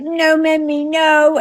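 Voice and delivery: put-on high, drawn-out child's protest; protesting toddler. Tired granddaughter to grandma about the bath, high protesting whine. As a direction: plead like a tired little kid.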